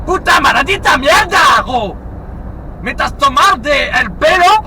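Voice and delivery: cris indignés en espagnol, plus aigus; mock outrage. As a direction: joue l'outré, fort. [name] outraged at Sanchez joke. (fausse colère mexicaine, criée)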